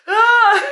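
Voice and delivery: loud, very high cry; emotional wail. Real, not acted. Reader overcome by the book, emotional wail. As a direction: let out a high, overwhelmed sob.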